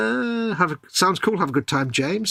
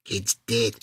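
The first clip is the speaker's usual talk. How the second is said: quiet, low grim psychic voice; Sylvia Brown; 5 semitones lower, 4 dB quieter than usual. Retold or imitated. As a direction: drop it low like a doom medium. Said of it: "you could do a brilliant Sylvia Brown".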